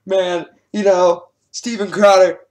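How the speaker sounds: loud, slow, hammed-up whining; mock Adam Conover. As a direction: fake devastated voice, drawn out. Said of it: "Adam Conover's just sitting there going".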